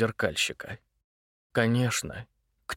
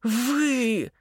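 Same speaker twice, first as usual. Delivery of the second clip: sharp, much higher hiss of accusation; 12 semitones higher than usual. Acted. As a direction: spit the word out like a cat. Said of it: "зашипела Варя". (злобное шипение)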